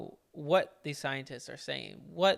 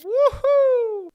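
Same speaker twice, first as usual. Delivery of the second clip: 1 excited whoop; 12 dB louder than usual. Real, not acted. Cheering the story's punchline.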